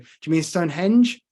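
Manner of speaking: normal talking voice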